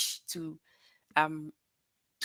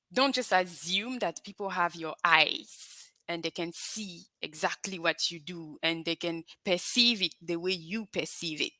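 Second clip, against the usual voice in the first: ordinary talk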